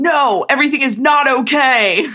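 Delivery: mock-exasperated outburst into the phone, half laughing; exasperated dad. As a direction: play the overwhelmed father. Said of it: "my dad saying into the phone".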